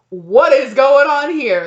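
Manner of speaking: loud, high, drawn-out disbelief; shocked confrontation. Acted. Someone walking in on a sleeper, shocked confrontation. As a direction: demand it slowly, scandalized.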